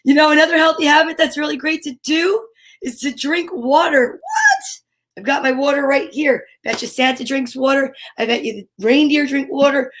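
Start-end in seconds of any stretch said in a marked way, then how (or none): 4.1-4.6 squealed high mock-surprised what. Host playfully surprised for kids, squealing high. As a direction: jump way up in pitch in playful shock.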